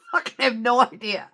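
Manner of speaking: high squealing laugh into the words. Real, not acted. Driver laughing at not knowing the tire was flat, squealing laugh. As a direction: crack up at yourself.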